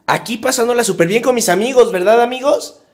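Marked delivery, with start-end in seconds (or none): none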